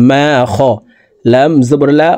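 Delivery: normal talking voice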